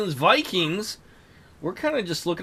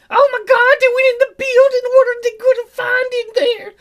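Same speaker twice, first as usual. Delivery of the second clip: much higher cartoonish excited voice; excited squeaky voice; 6 dB louder than usual. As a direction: squeal it like an eager little creature. Voicing an excited companion.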